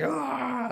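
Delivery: high, drawn-out mock scream; imitated dunk scream. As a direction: imitate a player's triumphant scream, high-pitched. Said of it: "He's screaming".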